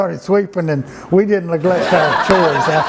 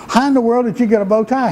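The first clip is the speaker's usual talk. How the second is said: higher, quick incredulous question; retold amazement; 7 semitones higher, much faster than usual. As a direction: imitate an astonished man demanding an explanation. Father amazed at the bow tie.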